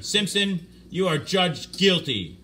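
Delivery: slow, solemn mock judge; a boy playing judge, pronouncing the sentence slowly; mock judge verdict.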